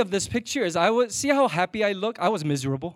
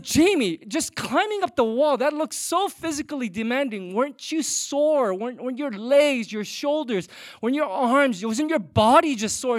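mock sympathy, sugary and sly; passive aggressive; 6 semitones higher, 5 dB louder than usual. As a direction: fake concern with a barb inside. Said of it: "I became a little bit passive aggressive".

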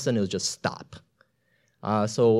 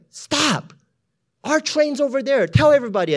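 very high and loud, urgent; shouting; 14 semitones higher, 5 dB louder than usual. Retold or imitated. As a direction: yell directions over the noise. Shouting directions in storm.

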